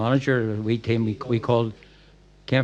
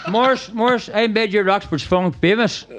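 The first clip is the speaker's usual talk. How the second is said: high, loud laughing call across the room; laughing shout; 10 semitones higher, 4 dB louder than usual. Real, not acted. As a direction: shout back at Morris through laughter. Laughing banter with heckler Morris.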